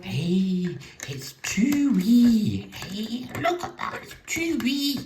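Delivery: high squeaky cartoon voice, far above his own; Whiskey and Brandy Bollins voice. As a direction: pitch it way up like a cartoon brother. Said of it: "Not a very good impression".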